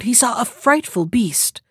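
plain reading voice

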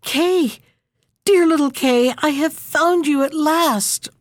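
high, bright call of relief; joyful cry. Acted. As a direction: call out to him with joy.

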